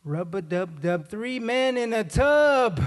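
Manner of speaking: very slow, climbing to a high held finish; drawn-out recital, rising to outrage; scandalized rhyme reading. Acted. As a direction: drag out each word in mock horror.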